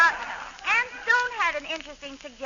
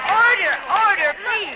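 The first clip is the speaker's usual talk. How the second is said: raised, slow call for order; shouting for order; 6 semitones higher, 8 dB louder, slower than usual. Acted. Chairwoman shouting over the ladies, a loud slow call for order. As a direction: bang the gavel with your voice, drawn out.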